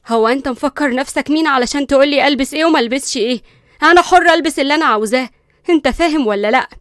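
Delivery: loud, challenging retort; defiant. Acted. Linda defying Qusay about her freedom, loud and defiant. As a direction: stand up to him, daring. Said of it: "بصيت له بتحدي".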